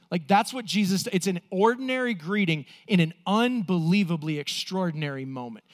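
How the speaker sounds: ordinary talk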